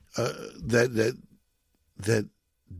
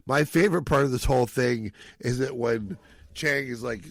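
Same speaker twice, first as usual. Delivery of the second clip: usual speaking voice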